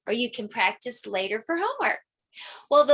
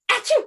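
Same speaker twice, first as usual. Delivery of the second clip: high, loud hammed-up achoo; loud fake sneeze; 14 semitones higher, 5 dB louder than usual. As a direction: burst out a big pretend sneeze. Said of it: "I've got the sneezies today".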